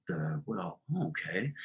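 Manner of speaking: hesitant frog. Acted. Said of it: "Frog says".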